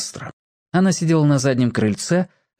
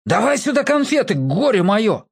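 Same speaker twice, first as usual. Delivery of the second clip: high mock-stern demand; menacing shout; 8 semitones higher than usual. Borka scolds his sister for the candy, mock-stern and high. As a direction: bark it like a cross big brother. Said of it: "грозно крикнул".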